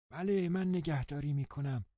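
put-on an old housekeeper, high and thin; old woman's voice. A narrator's reading. (صدای زیر و آرام پیرزن)

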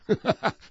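short, high scornful laugh; mocking laugh. Tom laughs off Gatsby's claim scornfully. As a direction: scoff out a laugh. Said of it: "تام چشمهایش را گرد کرد و خندید".

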